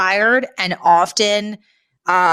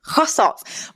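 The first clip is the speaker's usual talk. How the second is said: put-on French accent; 8 semitones higher than usual. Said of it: "I cannot do a French accent".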